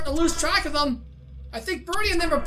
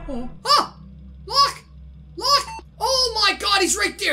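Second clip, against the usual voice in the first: frantic high yells; panicked shouting at the controls; panicking gamer; 5 semitones higher, 3 dB louder than usual. Real, not acted. Animatronic about to attack.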